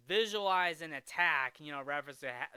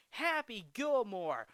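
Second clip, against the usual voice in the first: drawn-out mock greeting, pitched up; hailing by name; 6 semitones higher, 3 dB louder than usual. Heckler's son greeting the golfer, mock hailing. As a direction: call the name out slowly, imitating. Voicing Donald hailing Happy.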